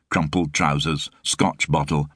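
usual speaking voice

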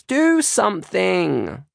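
put-on high, long-drawn child's wail; slow, high shriek demanding help. A narrator's reading. Maisie begging big sister to intervene, shrieking. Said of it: "Maisie shrieks".

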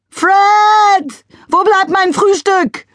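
high, loud, slow bark; barked demand. A narrator's reading. Wife calling her husband for breakfast, barking. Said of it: "bellte jemand ganz in der Nähe".

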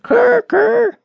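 very high-pitched raven croak; raven's croak. A narrator's reading. Pinched raven telling fortunes, high croaking. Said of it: "so that it croaked".

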